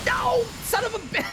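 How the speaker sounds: high, cracking shriek of frustration; squealing outrage; shrieked dismay. Real, not acted. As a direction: screech it as the run dies. Loses fight, shrieks.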